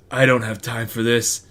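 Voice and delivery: low, put-on Nick Cage voice, lightly done; Nick Cage impression. Nick Cage brushing off the mom, low put-on voice. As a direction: growl it like a weary action hero. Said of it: "my Nick Cage impression".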